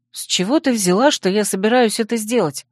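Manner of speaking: plain reading voice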